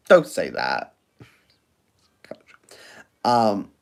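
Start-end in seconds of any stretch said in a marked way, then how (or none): 0.1-0.9 "Don't" dropped low and flat; low flat repeat. Shown as a demonstration. Answering the viewer again, low flat repeat. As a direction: repeat "don't say that" much lower. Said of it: "Oh, don't say that".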